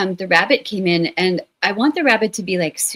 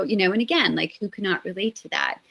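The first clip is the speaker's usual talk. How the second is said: ordinary delivery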